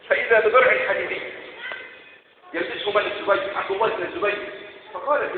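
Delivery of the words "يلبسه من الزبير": ordinary delivery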